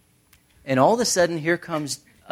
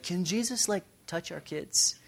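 higher, softer voice of pleading parents; timid parents' request; 5 semitones higher, 9 dB quieter than usual. Acted. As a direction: ask meekly for a small favour. Voicing parents asking a favour.

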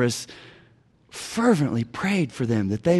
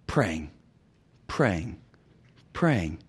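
lower, softer praying praying praying; soft repetition; 11 semitones lower, 6 dB quieter, much slower than usual. Shown as a demonstration. Pastor stressing hidden labor of prayer, soft and low. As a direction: let each praying fall away softly.

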